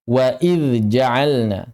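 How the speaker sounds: very slow "wa idz ja'alna"; "idz" kept apart from the following j; clear, unmerged dhal. Shown as a demonstration. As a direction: sound the dz of "idz" fully before "ja'alna". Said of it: "jangan diidghamkan".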